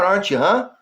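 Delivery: ordinary delivery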